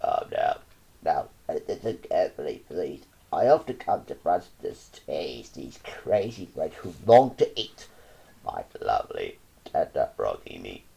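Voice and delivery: very low, calm toad voice; a deep unhurried magic toad; cool as cool. A narrator's reading. Magic toad calmly reassures the child, very low. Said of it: "said as cool as cool can be".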